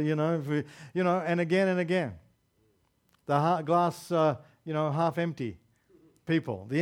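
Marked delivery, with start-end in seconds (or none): none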